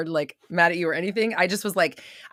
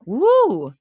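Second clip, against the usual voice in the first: excited whoop; 8 semitones higher, 10 dB louder than usual. Real, not acted. Excited greeting as Shantae joins.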